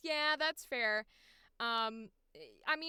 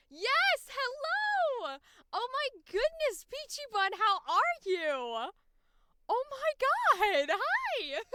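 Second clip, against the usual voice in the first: much higher, louder gushing hello; excited greeting; 10 semitones higher, 6 dB louder, slower than usual. Real, not acted. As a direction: gush at a favourite fan.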